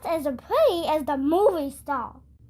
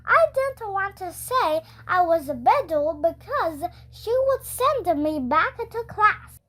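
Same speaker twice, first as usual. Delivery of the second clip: plain reading voice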